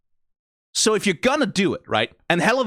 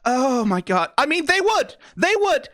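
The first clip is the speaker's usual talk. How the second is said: exasperated disbelief; 9 semitones higher than usual. Real, not acted. Exasperated at the characters' realization.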